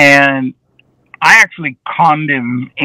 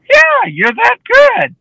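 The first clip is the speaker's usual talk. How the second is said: mock reassurance; slower than usual.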